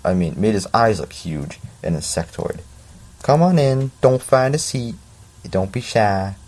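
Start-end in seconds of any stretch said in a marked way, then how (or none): 3.2-6.4 Southern teacher voice. Acted. Teacher greeting his class in a hint of Southern accent. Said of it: "Why is it always Southern?".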